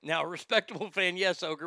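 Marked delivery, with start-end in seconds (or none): none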